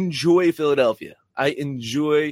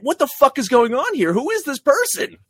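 shocked fan; 8 semitones higher, 4 dB louder than usual. Retold or imitated. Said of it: "And it's like".

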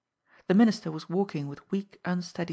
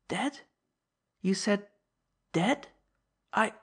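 stunned disbelief; 3 semitones higher than usual. Acted. Old man in shock.

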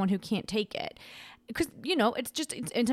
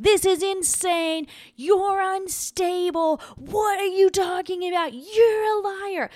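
high, slow, sneering mock voice; mocking angry commenter; 10 semitones higher, 4 dB louder, slower than usual. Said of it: "They were like".

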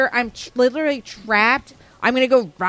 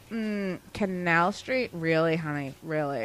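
1 slow low sass; 9 semitones lower, 10 dB quieter, slower than usual. Retold or imitated. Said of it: "and I'm just like".

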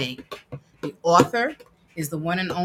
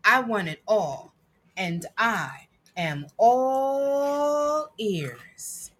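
put-on reading to children; 5 semitones higher than usual. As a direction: read it proudly to a child. Reads picture book aloud.

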